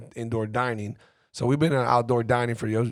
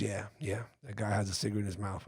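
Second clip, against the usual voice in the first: niece confirming, much softer, under the breath; hushed reply; 3 semitones lower, 12 dB quieter than usual. Retold or imitated. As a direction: whisper back so the smoker can't hear. Niece answers quietly.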